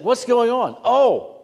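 high, loud question and answering "Oh"; retold excited exchange. Preacher retelling asking a bystander, excited and loud.

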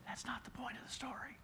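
stage-whispered aside; conspiratorial whisper to the congregation. Real, not acted. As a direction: lean in and whisper it like a secret.

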